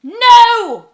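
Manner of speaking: high, very loud wail of despair; doomful cry. Acted. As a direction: cry out as if all is lost. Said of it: "cried the witch in a voice full of doom".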